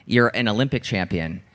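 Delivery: normal talking voice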